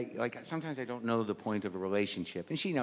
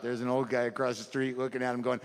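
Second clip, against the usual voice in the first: normal talking voice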